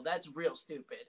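normal talking voice

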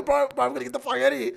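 high, fast retold alarm; mock panic. Telling Mick he's fleeing after seeing the paper, mock panic. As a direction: blurt it out urgently, half laughing.

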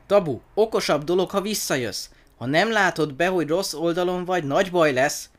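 earnest high-pitched persuasion; urging encouragement. A narrator's reading. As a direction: coax a scared friend back with a warning. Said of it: "és úgy bíztatta".

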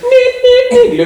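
high electronic neep neep; imitated beeping. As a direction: beep like a machine, high and quick.

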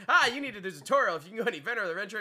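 game NPC. Pretend. Mock game NPC repeats line.

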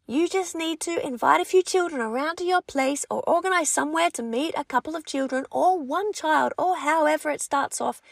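usual speaking voice

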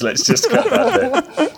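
high, slow, giggling request; laughing plea. Real, not acted. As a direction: plead through the laughter. Begging to cut while laughing.

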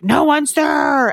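drawn-out mock demon rasp; demonic voice. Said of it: "some demonic, raspy voice".

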